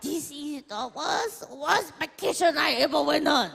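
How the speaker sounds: very high, half-crying mock mother; whiny mom impression. Mom moaning about her worst vacation, hammed-up crying. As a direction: wail like a wounded diva mom.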